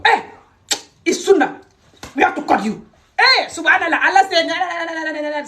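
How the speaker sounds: high-pitched outburst sliding into gabble. Retold or imitated. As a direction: imitate a man jumping in with pious babble.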